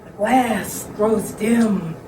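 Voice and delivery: slow, hushed mystic seer voice; fortune teller. Acted. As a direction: intone it like a séance medium.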